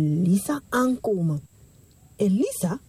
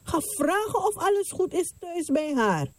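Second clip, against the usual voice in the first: urgent prophet; 10 semitones higher than usual. Acted. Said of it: "Zij zei tegen zijn knecht".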